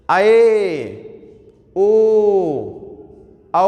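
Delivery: each vowel held long and clear; drawn-out vowel demo. Shown as a demonstration. Teacher demonstrates the vowels to students, drawn out. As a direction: sound out आए, ओ, औ slowly, one by one. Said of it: "फिर हमने बोला ए".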